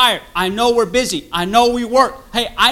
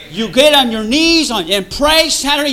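usual speaking voice

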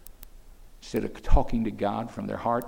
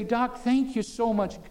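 grateful patient; 8 semitones higher than usual. Acted. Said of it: "you would say".

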